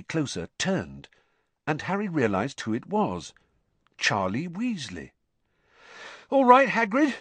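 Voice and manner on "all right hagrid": breathless, high and loud; panting. Acted. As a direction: pant it out, winded from the dragons. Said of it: "he panted".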